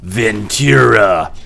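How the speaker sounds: slow, drawn-out demon voice on "Ventura"; a hint of a demon calling slowly; demonic voice. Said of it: "the demonic voice was like".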